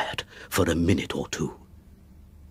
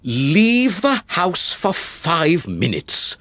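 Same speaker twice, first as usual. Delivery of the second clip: slow, higher incredulous echo; 7 semitones higher, slower than usual. Acted. Father echoes the son's request, slow disbelief. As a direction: repeat it in disbelief.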